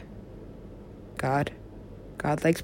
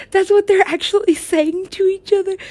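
high, incredulous question; can't believe what they're saying; shocked disbelief; 14 semitones higher, 4 dB louder than usual. Real, not acted. Watching the film, stunned by the dialogue, high disbelief. Shocked realization during video.

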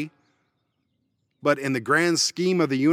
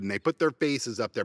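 ordinary delivery

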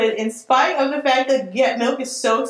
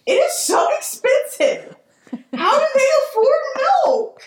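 laugh-crying; 13 semitones higher than usual. Real, not acted. Laugh-crying over milk prices.

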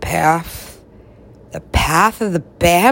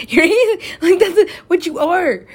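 laughing through the words, pitched higher; 10 semitones higher, 4 dB louder than usual. Real, not acted. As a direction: giggle it out while teasing. Laughing while teasing friend.

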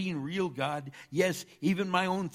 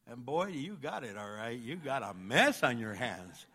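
ordinary delivery

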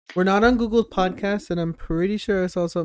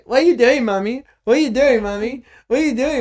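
put-on child voice; 7 semitones higher, 9 dB louder than usual. Kid voice imitating child asking mommy.

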